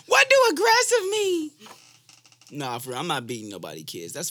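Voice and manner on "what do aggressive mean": put-on very high, loud kid voice; mock little kid. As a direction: squeal it like a kid asking mama. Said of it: "little kids around here just running around saying".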